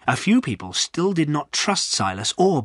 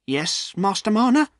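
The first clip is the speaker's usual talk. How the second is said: high, uneasy reply; slightly trembling; 9 semitones higher than usual. A narrator's reading. Poacher answering his accuser, a little afraid. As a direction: answer with timid caution. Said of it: "trembling a little".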